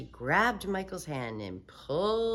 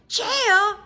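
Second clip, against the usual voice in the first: high delighted shout; thrilled little girl; excited yell; 10 dB louder than usual. Acted. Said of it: "yelled Sheila".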